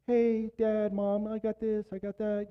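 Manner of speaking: put-on high, even-pitched kid voice; lightly put-on high child voice; imitated child. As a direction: imitate a kid calling on parents. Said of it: "when your kids are hurting".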